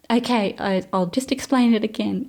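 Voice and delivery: retold re-explaining. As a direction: imitate a coworker starting the explanation over. Retold coworker offers to explain again.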